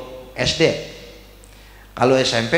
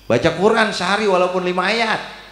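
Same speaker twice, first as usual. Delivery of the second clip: ordinary talk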